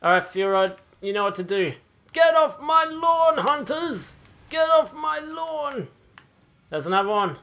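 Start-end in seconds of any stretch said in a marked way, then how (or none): 2.1-4.1 put-on high, slow, cranky old man shout; shouting at trespasser. 4.5-5.9 put-on high, slow, cranky old man shout; shouting at trespasser.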